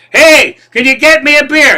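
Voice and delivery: high, loud yell over a blaring bar; shouting over noise. Acted. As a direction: holler to someone who can't hear you. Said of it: "turn 'em up real loud so that you can't even hear".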